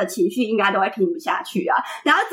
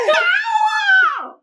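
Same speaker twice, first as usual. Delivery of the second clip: high wailing sob-shout, far above her usual voice; half-laughing as she wails the accusation; crying shout. Retold or imitated. To her boyfriend after he hit her helmet, a wailing cry. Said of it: "扒下去我就开始大哭".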